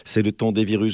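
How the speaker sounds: usual speaking voice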